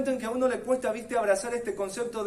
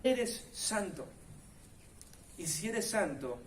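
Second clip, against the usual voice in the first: soft declaration; 7 dB quieter, slower than usual. Real, not acted. Soft slow declaration after loud quote.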